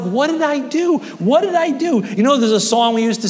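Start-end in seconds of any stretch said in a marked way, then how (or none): 0.0-2.0 high, hurt pleading question; God pleading. Acted. God asking people walking away, high and pleading. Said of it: "God's like".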